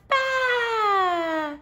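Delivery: a high shaky sheep bleat; bleating baa. Acted. Sheep greeting Little Blue, bleating. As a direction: bleat it like a lamb. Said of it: "Sheep said".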